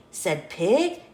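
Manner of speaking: ordinary delivery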